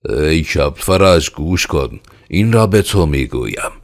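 irate yelling of a boss; angry shout. Acted. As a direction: shout at the peasant to make him listen. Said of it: "خشمگین شد و فریاد زد".

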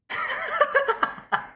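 a sudden high squeal of a laugh; high delighted laugh. Real, not acted. Streamer laughing as her car trick works.

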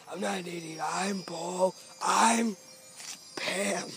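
caveman voice. Retold or imitated. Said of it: "I thought you would say something like".

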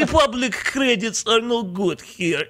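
high, slow alien trader impression; exaggerated junk dealer caricature, drawn out; Watto. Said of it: "not hitting the mark how Watto talks".

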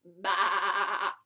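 low bleating sheep; sheep baa. Pretend. Imitating a sheep.

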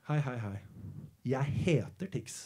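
low, soft, slowing introduction; Andreas's calm reply. Retold or imitated. Andreas answering the bullies, calm low voice. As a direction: answer the bullies quietly and unhurriedly. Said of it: "Og da sier han bare".

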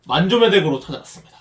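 ordinary talk, nothing marked

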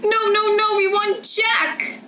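put-on children refusing to go without their dog; children pleading. A narrator's reading. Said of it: "they cried".

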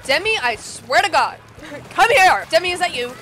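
loud, high scolding shouts; exasperated threat. Real, not acted. Player summoning her rival Demi, exasperated shouting. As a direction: yell at a pest to come over.

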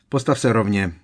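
curt, irritated telling-off; impatient rebuke. A narrator's reading. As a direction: snap the command at the elf. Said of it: "napomenul ho netrpělivě Sirius".

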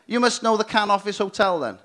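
ordinary talk

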